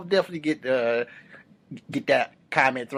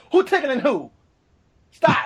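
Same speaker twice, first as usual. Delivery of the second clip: high, calling out from another room; strict dad shouting a ban; stern parent call; 12 semitones higher, 3 dB louder than usual. Retold or imitated.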